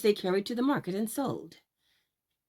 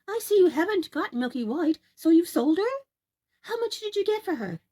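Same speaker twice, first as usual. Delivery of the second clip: high, louder eager mother's voice; 10 semitones higher, 5 dB louder than usual. Acted. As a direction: press him for the price. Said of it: "said his mother".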